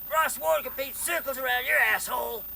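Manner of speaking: high, very loud boastful taunt; Ross Ward yelling. Retold or imitated. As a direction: holler the boast like an insult.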